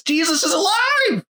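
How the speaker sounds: high, breaking "Jesus is alive"; tearful joy. Retold or imitated. Evangelist marvelling to the pastor, high and tearful. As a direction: let the voice crack with wonder.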